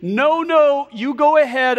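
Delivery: high, loud refusal called upward; calling back. Acted. Stranded man waves off yelling pilot.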